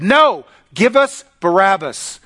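loud, high, slow refusal; emphatic demand. Acted. Crowd answering Pilate's offer, loud emphatic demand. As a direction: demand it as a crowd would, word by word.

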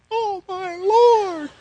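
put-on high, slow, drawn-out shocked girl; shocked daughter. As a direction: imitate the horrified daughter, stretched out. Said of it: "my daughter's standing on the deck going".

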